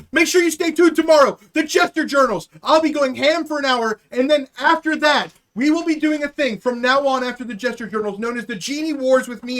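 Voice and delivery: loud, high-energy plug to viewers; stream announcer. Real, not acted. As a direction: hype up tomorrow's show to the chat.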